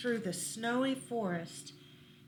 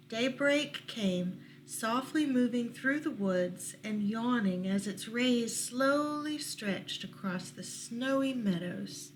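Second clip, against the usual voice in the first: usual speaking voice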